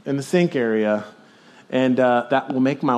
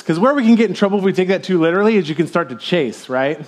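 ordinary delivery

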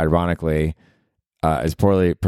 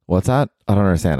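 normal talking voice

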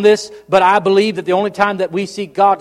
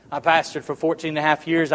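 normal talking voice

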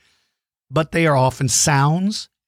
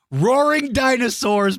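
excited; 8 semitones higher, slower than usual. Real, not acted. Delighted after birthday video.

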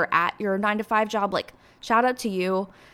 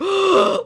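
loud, high gasp imitating her friend's shock; mock gasp of wide-eyed surprise; imitated shocked gasp; 11 semitones higher, 9 dB louder than usual. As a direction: gasp sharply like a friend hearing the news. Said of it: "And she was like".

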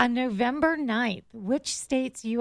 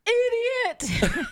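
playful high echo; 12 semitones higher, 3 dB louder than usual. Real, not acted. Teasing echo of self-insult.

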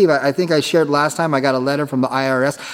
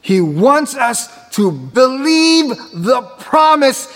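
full-force preaching; 4 semitones higher, slower than usual. Acted. Preacher urging the congregation to believe the promise, slow and soaring. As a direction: build to the sermon's peak, stretching words.

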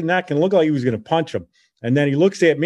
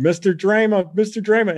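retold yelling; 8 semitones higher than usual. Boy pounding on a neighbor's door for help, mock yelling. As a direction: imitate your own urgent yell, pitched up.

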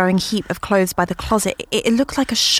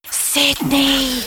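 eerie call; 7 semitones higher than usual. Acted. Voice calling from the dark.

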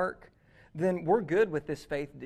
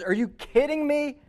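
loud, higher, exasperated mock rant; angry outburst; 5 semitones higher, 7 dB louder than usual. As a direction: blow up at him like an outraged teacher. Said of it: "surely Jesus is angry at that".

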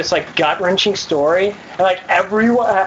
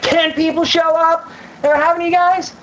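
incredulous venting; 8 semitones higher than usual. Real, not acted. Venting about a failed club.

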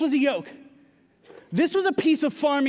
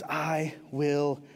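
very slow, much lower and softer; gentle Jesus; 7 semitones lower, 6 dB quieter, much slower than usual. Acted. Preacher voicing Jesus' invitation, slow and soft. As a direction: lay each word down slowly and tenderly. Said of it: "And Jesus says".

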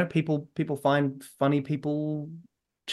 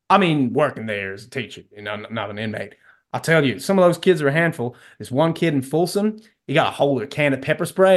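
put-on heavy American South accent. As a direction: do a terrible Texan accent. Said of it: "Dean, in a heavy American South accent".

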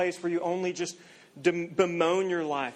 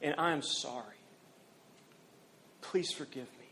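humble apology; 4 semitones lower, 8 dB quieter than usual. Acted. Humble confession prayer to God.

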